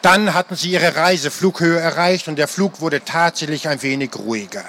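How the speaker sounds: ordinary delivery, nothing marked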